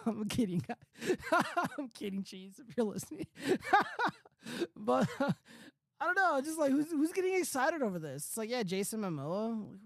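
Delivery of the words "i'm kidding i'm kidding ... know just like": real laughter through the words; laughing it off. Real, not acted. Laughing while telling Cheese he's only kidding. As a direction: giggle out I'm kidding. Teasing his former co-host.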